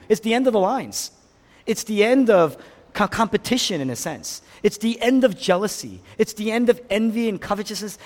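high, insistent preacher's build; impassioned preaching. Real, not acted. Preacher tells congregation grace ends all snobbishness, impassioned preaching. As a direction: hammer out the list, rising to a loud challenge.

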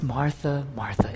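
soft, fading to a whisper on the second Martha; Jesus tenderly counseling Martha in a hushed voice; tender, emotional. Pretend. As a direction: breathe the name out with compassion. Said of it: "intense emotional expression".

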